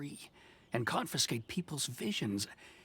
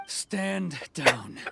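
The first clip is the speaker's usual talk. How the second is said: clipped order, louder; firm command; 4 semitones higher, 6 dB louder than usual. Acted. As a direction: cut him off with authority. Commander orders soldier back.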